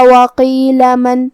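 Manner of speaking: وقیل من joined on, slow; connected recitation. Shown as a demonstration. Teacher demonstrates joined reading, slow recitation. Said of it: "ملا کے پڑھیں تو ہم زبر ظاہر کریں گے".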